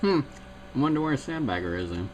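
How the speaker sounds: usual speaking voice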